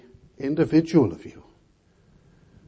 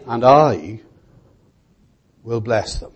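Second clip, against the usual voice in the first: I set apart by a long pause after it; stress on I; 2 semitones lower than usual. Real, not acted. Preacher reading the Lord's promise, stress on I. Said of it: "I" there is emphatic".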